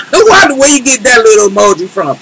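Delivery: amused, high laugh into the question; laugh, then ask through the tail of it. Real, not acted. Host asks viewer about a little emoji, laughing.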